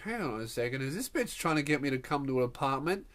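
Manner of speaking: low, soft aside to self; suspicious inner thought. Retold or imitated. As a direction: think it privately, hushed and low. Suspicious inner thought while she talks.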